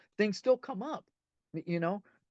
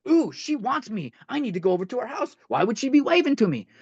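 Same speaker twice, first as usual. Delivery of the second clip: higher, louder, eager self-talk; excited ego voice; 7 semitones higher, 6 dB louder than usual. Acted. Man's ego reading into ex-girlfriend's wave, excited self-talk. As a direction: play the ego getting carried away. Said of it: "the ego's kicking in and it's going".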